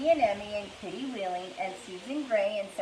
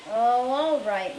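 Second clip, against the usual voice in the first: slow, grudging give-in; reluctant sigh; 3 semitones higher, 6 dB louder, much slower than usual. A narrator's reading. Girl gives in about the dishes, grudging. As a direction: drag it out unwillingly. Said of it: "Melody said".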